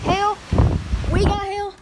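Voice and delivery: high, loud startled call; excited shout. Real, not acted. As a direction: shout out the sudden hail. Hail suddenly starts falling.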